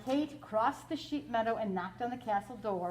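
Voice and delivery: plain reading voice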